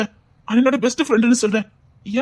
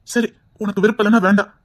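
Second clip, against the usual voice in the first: ordinary talk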